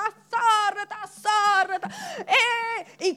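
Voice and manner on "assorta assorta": mock crowd complaint. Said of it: "tutte le cose che ha dovuto affrontare".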